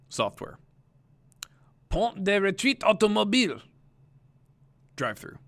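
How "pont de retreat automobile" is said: "Pont de retreat automobile" said in French, high and loud; French phrase. Shown as a demonstration. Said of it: "I'm gonna say the word".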